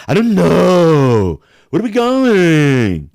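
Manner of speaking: mocking a Florida girl, slow and drawn out; Florida girl twang. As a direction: drag it out like a Florida party girl. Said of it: "That's how girls talk down here".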